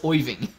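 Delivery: "Oiving" with "oy" in place of "Ir"; comic "oy" opening on "Oiving", drawn out; pronunciation shown. Shown as a demonstration. Streamer jokingly teaching how Irving is pronounced, exaggerated vowel. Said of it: "It's pronounced Oiving".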